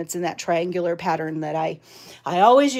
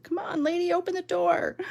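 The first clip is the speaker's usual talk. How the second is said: high-pitched impatient pet voice; high, put-on doggy plea; voicing the dog; 9 semitones higher, 4 dB quieter than usual. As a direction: play the dog demanding to be let in, pitched well up. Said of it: "She's like".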